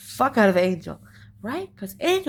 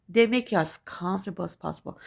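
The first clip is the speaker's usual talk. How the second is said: ordinary delivery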